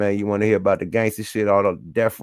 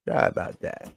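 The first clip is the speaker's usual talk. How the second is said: breathy whispered sorry, no voice; whispered apology; 6 dB quieter than usual. Real, not acted. Whispering an aside apology to the viewers. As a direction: whisper it off-mic, almost unheard.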